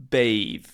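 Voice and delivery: bathe said as "bayth", /peɪv/; misread as bathe. Retold or imitated. Panicking student misreads the board word as bathe. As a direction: read bath as bathe, the long AY vowel. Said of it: "I read it as".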